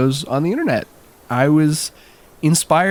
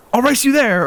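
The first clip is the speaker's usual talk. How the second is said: put-on kid's challenge; 11 semitones higher than usual. Kid challenging friends.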